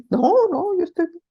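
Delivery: high, loud mock denial, clearly upset; an overbright I'm fine that says the opposite; insisting she's fine. Wife tells husband she's fine while angry, in a high loud denial.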